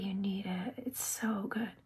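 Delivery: hushed conspiratorial whisper. Real, not acted. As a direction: whisper it like insider advice. Urging viewers to buy brush.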